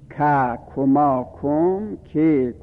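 ک، کما said very slowly, each form held apart; slow recitation. Shown as a demonstration. Teacher to students, listing ک، کما very slowly. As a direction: drill ک، کما slowly for the class.

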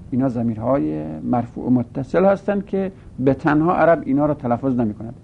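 ordinary talk

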